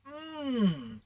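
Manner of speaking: ordinary delivery, nothing marked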